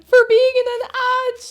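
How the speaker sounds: high, slow, disbelieving squeal; incredulous laugh. Real, not acted. Streamer telling chat about a spam accusation, incredulous high squeal. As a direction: sound amazed at the absurdity.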